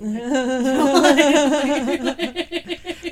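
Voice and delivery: big hearty laugh breaking out; loud burst of laughter. Real, not acted. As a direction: laugh out loud, high and full. Big laugh at cremation joke.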